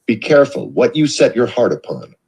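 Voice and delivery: ordinary delivery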